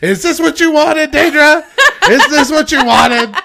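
high, loud, laughing tease; laughing taunt. Retold or imitated. Husband teasing his wife with snow, high laughing taunt. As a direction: cackle it as you get revenge. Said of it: "And I said".

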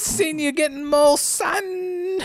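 high, laughing, hammed-up British voice; hammed-up British Widmore. Asked to do Widmore, a hammed-up high British voice. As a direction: overdo the British villain, cracking up. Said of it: "do Widmore for me".

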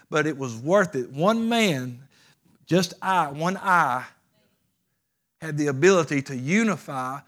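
loud, rousing sermon delivery; emphatic preaching. Real, not acted. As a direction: preach it with force, punching key words. Preaches at full force.

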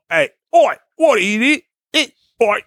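over-the-top mock Cockney yelling Oi; bad Cockney accent. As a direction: ham up a Cockney geezer calling out. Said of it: "no one's asked either of you to do accents".

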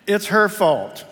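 loud, high, slow finger-pointing; mock blame. Man blaming his wife in front of God, slow and loud. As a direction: drag it out blaming her. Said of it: "Some things never change".

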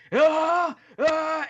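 high, loud "Ah!" war cry; high-pitched imitated scream; imitated wrestler's yell. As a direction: bellow like a wrestler powering up. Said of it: "Mizunami was all like".

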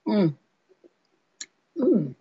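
sipping then savouring; while sipping a drink. Real, not acted. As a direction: mm over a sip of cocktail. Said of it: "That's quite nice".

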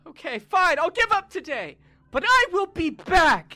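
very high, loud, slowing at end; high villain vow. Acted. The thrown boy vows from over the fence to come back, high and loud. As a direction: vow revenge like a cartoon villain.

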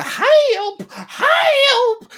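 loud, very high-pitched damsel wail; princess crying for help. Acted. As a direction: shriek for rescue like a hammed-up princess. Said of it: "as a princess".

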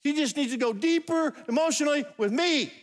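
high-pitched imitation of a wanting wife; mock complaining wife. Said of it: "Women, you're saying".

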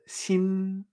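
"sin" held on one even note; level first tone. Shown as a demonstration. Teacher showing the first tone, "sin" held level. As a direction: sustain "sin" without rise or fall. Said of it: "el tono primero".

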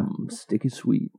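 soft, syrupy mock seduction; caramely voice. Shown as a demonstration. Host shows his sexy interpretation, caramely voice. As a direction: ooze the words quietly. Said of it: "It's just caramely, caramely voice".

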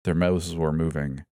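ordinary delivery

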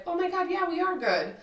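high-pitched phony reassurance; fake friendliness. Pretending to a friend that they're good, fake friendliness. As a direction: pitch it up, falsely agreeable.

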